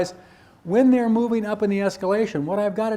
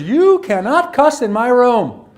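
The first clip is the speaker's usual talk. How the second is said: slow, loud and higher stern scolding; strict teacher; 6 semitones higher, 10 dB louder, slower than usual. Retold or imitated. Teacher mimicking a colleague scolding a student, slow loud stern. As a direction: spell out each word like a fed-up teacher. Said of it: "and the teacher goes".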